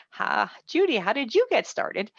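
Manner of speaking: normal talking voice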